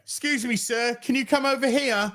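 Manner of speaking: mock polite official calling him over; lightly mocking customs officer; officious officer. Officer voiced in story.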